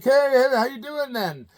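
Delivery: high, loud, tipsy-sounding British greeting. Retold or imitated. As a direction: greet the fan like a buzzed rock star. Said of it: "He's like".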